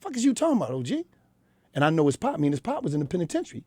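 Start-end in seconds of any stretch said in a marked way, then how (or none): 0.0-1.0 higher, scornful mock of the kid; mimicking a frustrated young boy. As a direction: throw the kid's scoff back, pitched up. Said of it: "that's what I mimic".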